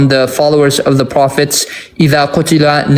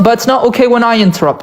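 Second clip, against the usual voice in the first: indignant fast protest; 7 semitones higher, faster than usual. Real, not acted. Heated quarrel over interruptions.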